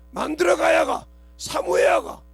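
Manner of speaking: 목청껏 외치며 강조하듯; 힘주어 외치듯 선포해; shouted. Acted. Preacher shouting the point. (설교자가 회중에게 외치는 강조)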